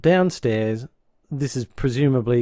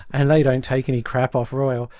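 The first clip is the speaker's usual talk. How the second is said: usual speaking voice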